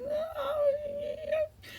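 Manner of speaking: very high, soft whiny puppy voice; imitating a whinge. Copying the crying dog, high whiny whimper. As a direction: mimic the dog's little whimper. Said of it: "he gets this little whinge".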